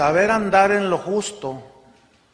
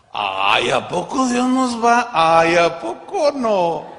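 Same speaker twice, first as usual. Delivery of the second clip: mock disbelief; 5 dB louder, slower than usual. Mocking believers' disbelief.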